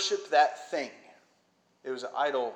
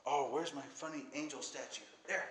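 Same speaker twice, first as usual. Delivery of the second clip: muttered aside; 10 dB quieter than usual. Real, not acted. Preacher hunting for a prop, muttering to self. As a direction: drop well under your preaching volume.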